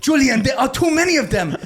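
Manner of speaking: hammed-up panic, pitched high; mock panic. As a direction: plead that there are too many. Friend's retold despair.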